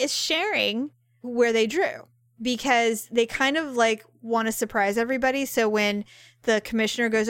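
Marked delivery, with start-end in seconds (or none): none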